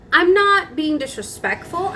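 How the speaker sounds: high-pitched mock yelling in self-defence; retold heated protest. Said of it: "then I was yelling at her".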